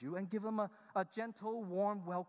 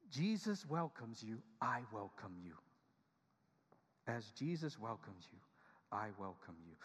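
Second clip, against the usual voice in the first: lower, softer welcoming words; tender welcome; 7 semitones lower, 4 dB quieter than usual. Shown as a demonstration. Pastor modelling welcome words to congregation, tender. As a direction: say it gently to a stranger. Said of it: "is to say".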